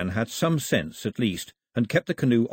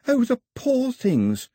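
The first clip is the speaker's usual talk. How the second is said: put-on high girl voice; 14 semitones higher, slower than usual. Daphne voiced, sympathetic.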